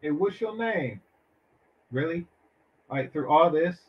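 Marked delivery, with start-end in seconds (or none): none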